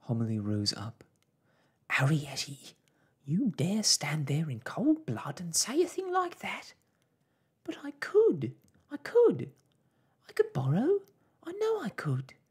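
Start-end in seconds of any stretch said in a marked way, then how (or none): 1.9-6.8 loud, high motherly outrage; outraged scolding. Acted. Mother rebukes daughter's bold idea, outraged. 7.6-12.3 put-on very high, loud girlish pleading; insistent protest. Daughter insists she could borrow, high insistent protest.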